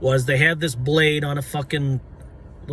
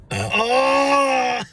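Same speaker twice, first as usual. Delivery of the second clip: strained yell; 8 semitones higher than usual. Real, not acted.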